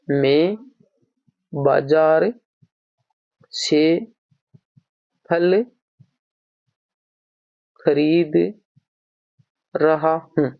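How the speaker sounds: each word set apart by long pauses. Shown as a demonstration. Teacher breaking a sentence into words for students, slow and spaced. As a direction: spell the sentence out one word at a time, slowly. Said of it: "अब हम देख रहे हैं".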